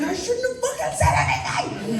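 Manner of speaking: high-pitched, laughing mock regret; hammed-up dismay through a laugh; mock gutted. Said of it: "was gutted".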